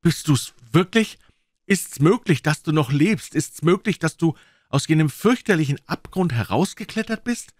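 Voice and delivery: high, loud breathless astonishment; overwhelmed disbelief. Acted. Watson to Holmes, barely believing he lives, high excited disbelief. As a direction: pour it out in stunned amazement.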